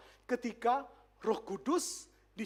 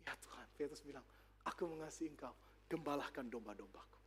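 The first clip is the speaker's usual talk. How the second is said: humble Peter, hushed; 11 semitones lower, 17 dB quieter than usual. Retold or imitated. Peter answers Jesus softly.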